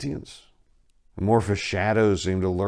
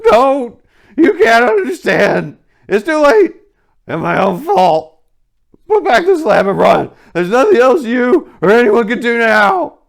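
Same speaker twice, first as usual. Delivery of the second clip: much higher, much louder anguished scream; scream of despair; 14 dB louder than usual. A narrator's reading. Warren screaming at Carter not to come, despairing scream. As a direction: scream hopelessly at him. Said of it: "To scream of utter despair".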